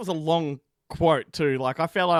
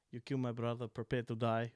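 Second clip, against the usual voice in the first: Inigo Montoya; 4 semitones lower, 7 dB quieter than usual. Acted. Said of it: "And you did an accent".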